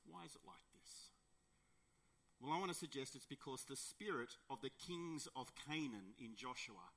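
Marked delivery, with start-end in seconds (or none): 0.1-1.1 very soft, almost to oneself; hushed question. Real, not acted. Preacher pondering why believers give in, very hushed questioning. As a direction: let it sink to a near murmur. Repeats the question more quietly.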